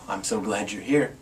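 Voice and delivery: ordinary delivery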